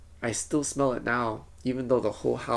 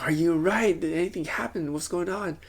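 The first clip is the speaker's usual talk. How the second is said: mild re-enacted yell, pitched up; retold yelling; 6 semitones higher than usual. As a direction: act out anxiously calling to someone. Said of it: "And I was yelling".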